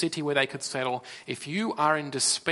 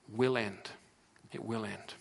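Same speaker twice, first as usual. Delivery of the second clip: softer, hushed promise; quiet, certain comfort; soft reassurance; 5 semitones lower, 8 dB quieter than usual. Real, not acted. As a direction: lay it down gently like a comfort. Preacher comforts despairing listeners.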